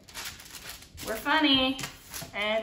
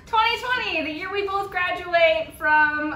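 ironic celebration; 5 semitones higher, 8 dB louder than usual. Pretend. Ironic celebration after laugh.

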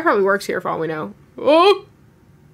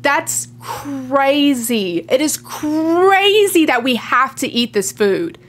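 dramatic slow drawl; much slower than usual. Acted. Dramatic drawn out line.

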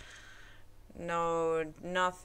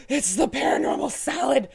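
spooky announcer; 8 semitones higher, 7 dB louder than usual. Pretend.

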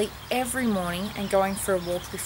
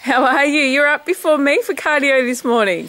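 high and loud, playful surprise; loud excited greeting; excited teasing; 6 semitones higher, 11 dB louder than usual. Real, not acted. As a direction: tease her brightly, louder. Surprising mum, teasing her.

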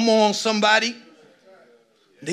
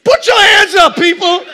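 much higher, much louder shout to the room; shouted call; 13 semitones higher, 16 dB louder than usual. Real, not acted. Pastor urging the congregation to raise hands, shouting. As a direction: yell it out to get hands up.

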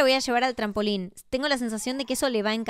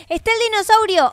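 much higher and louder, thrilled; excited find; 8 semitones higher, 7 dB louder than usual. Acted. Mother finding the dinosaur toy, thrilled. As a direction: cry out as you spot it.

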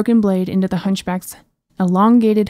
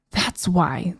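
soft hushed warning; 2 semitones lower, 5 dB quieter than usual. Acted. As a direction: whisper it with dread. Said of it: "the princess hushed".

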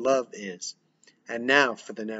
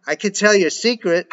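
loud, higher yell; 7 semitones higher, 7 dB louder than usual. Acted. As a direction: yell it through a door. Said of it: "yelling through the door".

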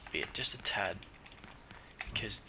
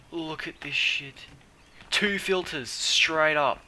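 excited; 6 semitones higher, 9 dB louder, slower than usual. Real, not acted. Overjoyed at finding loot.

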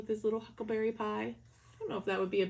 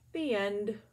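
usual speaking voice

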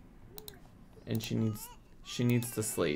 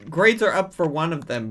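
loud surprise; 4 semitones higher, 8 dB louder than usual. Real, not acted.

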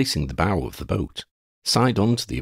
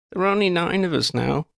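higher-pitched gentle correction; correcting; 11 semitones higher than usual. A narrator's reading. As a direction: correct him in a higher woman's voice. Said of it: "Lydia corrected him".

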